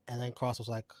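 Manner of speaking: ordinary talk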